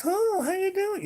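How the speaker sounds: very high, sweet, guileless greeting; imitated childlike aunt, high-pitched; little-girl voice. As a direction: put on an innocent little girl's squeak. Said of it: "she was like this little girl".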